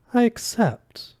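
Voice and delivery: put-on slow, high-pitched French-accented acceptance; fox god, accepting. A narrator's reading.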